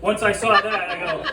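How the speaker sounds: usual speaking voice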